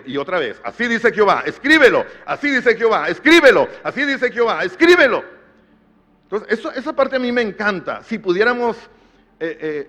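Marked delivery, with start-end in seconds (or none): 0.6-5.2 each repeat rising louder and higher; building command. Acted. Preacher voicing God's command to Jeremiah, building to a ringing shout. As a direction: preach it like God's order, growing with each call.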